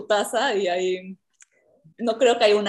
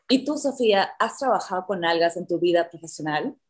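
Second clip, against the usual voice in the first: normal talking voice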